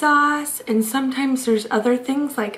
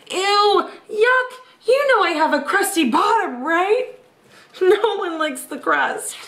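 higher, huffy cartoon pizza voice; indignant pizza slice; 5 semitones higher than usual. Pizza slice protests to the reader about being eaten, higher and huffy. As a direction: protest with mock disgust.

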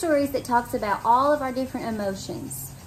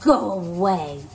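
low, slow grumpy growl; grouchy ladybug; 4 semitones lower, slower than usual. Acted. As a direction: lower and slower, a grump. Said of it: "said the grouchy ladybug".

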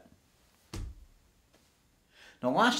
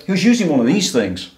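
usual speaking voice

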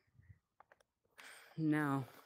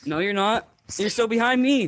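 loud calling out; 6 semitones higher, 8 dB louder than usual. Real, not acted. Arguing with player over lead.

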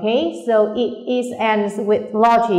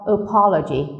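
apology stressed on its second syllable, PO. Shown as a demonstration. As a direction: put the beat of apology on po. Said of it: "chúng ta nhấn vào po".

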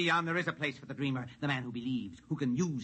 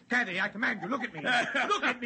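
desperate command; 11 semitones higher, much faster than usual. Acted. George's hypnosis fails, desperate.

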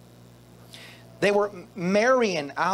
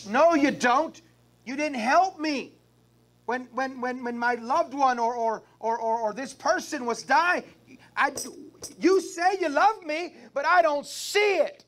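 whiny complaint; 6 semitones higher than usual. Retold or imitated. People answering God back.

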